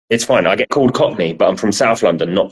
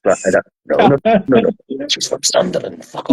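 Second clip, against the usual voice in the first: higher-pitched, indignant imitation of an offended relative; mock outrage; 9 semitones higher than usual. Relative mistaken for a Geordie by family, mock outrage. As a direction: play up someone else's affronted protest. Acts out Sarah's offended reaction.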